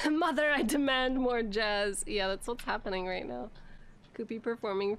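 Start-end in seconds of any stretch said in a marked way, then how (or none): 0.1-2.0 higher, louder diva demand. Acted. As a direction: stretch it out like an imperious little diva. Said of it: "Cooper really is a diva".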